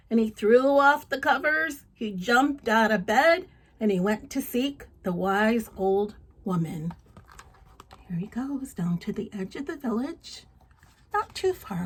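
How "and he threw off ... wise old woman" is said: louder, lively read-aloud storytelling; story narration. Acted. Reading a folk tale to children, lively narration. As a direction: tell it with energy to a child.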